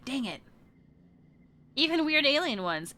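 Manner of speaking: ordinary talk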